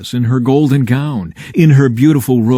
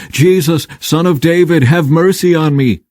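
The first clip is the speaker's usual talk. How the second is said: raised, higher calling-out voice; shout; 6 semitones higher than usual. A narrator's reading. Blind beggar calling out to Jesus, raised pleading shout. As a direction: call out to be heard over the crowd. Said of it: "he began to shout".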